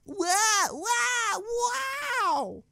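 high, far mock gasps of wow; mock underwhelmed wow. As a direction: parody a hollow reaction. Mocking a flat reaction scene.

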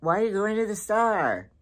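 retold puzzled question to husband. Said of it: "and I said".

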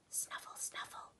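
whispered snuffle, snuffle; hedgehog snuffling. Acted. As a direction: sniff softly like a hedgehog.

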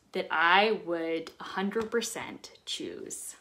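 soft slow whisper. Real, not acted. Vlogger admits her choice to viewers in a slow whisper. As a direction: hush it like a private confession. Confessional hushed ending.

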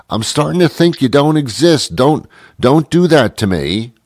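ordinary talk, nothing marked

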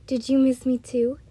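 ordinary delivery, nothing marked

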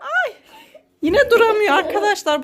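high squeal melting into laughter; laughing surprise. Real, not acted. Mother tells viewers her daughter keeps falling, high laughing squeal. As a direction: giggle through it as she falls again.

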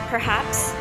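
plain reading voice

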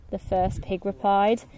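ordinary delivery

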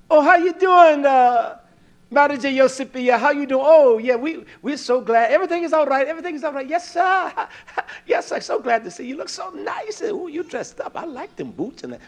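butter up the boss, sugary and eager; fawning flatterer. Pretend. Fawning over manager who walks in.

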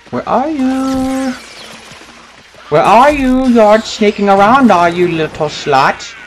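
high, slow creepy taunt calling out; taunting villain voice. Pretend. Gamer taunting a hidden enemy in a high creepy voice. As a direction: stalk the hiding player with a sing-song menace.

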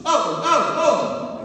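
loud, high demo groans; loud, high Southern Italian groan shown as a gesture word; inarticulate groans. Shown as a demonstration. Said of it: "the dictionary of inarticulate groans ... like this".

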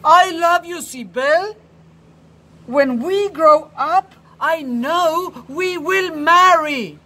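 high, loud sudden declaration; blurting out. A narrator's reading. Oscar blurting his love to Sibel, loud and sudden. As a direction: blurt it all out at once. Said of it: "blurts out".

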